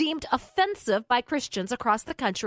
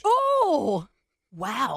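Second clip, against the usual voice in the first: amazed reaction. Real, not acted. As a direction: react as if a revelation just landed. Reacting to the sausage punchline.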